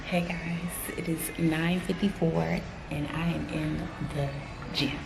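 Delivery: hushed whisper in the gym. Real, not acted. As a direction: whisper so nobody hears.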